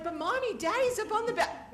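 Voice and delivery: put-on excited child. Little girl to her mother at the lagoon, high excited plea. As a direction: tug at mom's attention, childlike. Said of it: "And I'm like".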